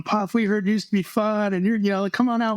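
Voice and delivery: put-on high, coaxing young teammates; teasing teammates. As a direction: egg the veteran on like a rookie. Teammates coaxing the old guy.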